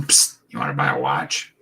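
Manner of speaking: low, furtive street hustler voice; secretive raspy sales murmur. Retold or imitated. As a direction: sell a fake watch under your breath. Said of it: "peeping at us on the corner going".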